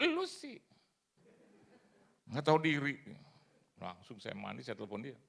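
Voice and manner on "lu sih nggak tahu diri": high loud rebuke; scolding God. Retold or imitated. God rebuking the preacher for asking, high loud rebuke. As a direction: snap it at him, you ungrateful man.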